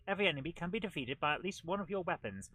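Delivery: normal talking voice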